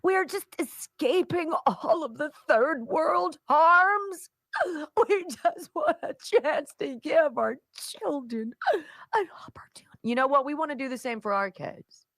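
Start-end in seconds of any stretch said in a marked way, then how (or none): 0.0-10.0 high, slow, pleading mock whimper; sarcastic impersonation of a self-pitying group; mocking whiny victim voice. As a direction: parody a pitiful plea, drawn out and trembling into a whisper. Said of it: "the Somalians are not very happy".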